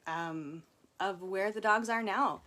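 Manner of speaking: ordinary talk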